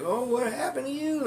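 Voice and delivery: high-pitched concerned mother voice; imitated mom. Mom greeting her beaten son at the door, high imitated voice. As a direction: voice a mother's question well above your own pitch.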